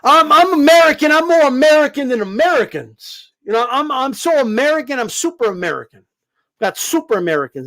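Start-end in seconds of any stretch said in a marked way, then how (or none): 0.0-6.0 lightly hammed-up proud American; mock YouTube patriot. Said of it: "We got dudes on YouTube like".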